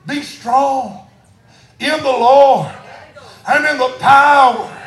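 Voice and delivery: loud, high, slow preacher's shout; full-force preaching. Real, not acted. Preacher rallying the church with scripture, full-force shout. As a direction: belt out each phrase with drawn-out power.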